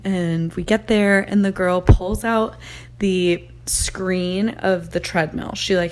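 usual speaking voice

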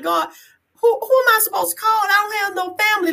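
high, fast and tearful; crying to God. Retold or imitated. Alone on her couch, begging God, tearful. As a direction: plead upward, near tears. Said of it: "I'm just crying like".

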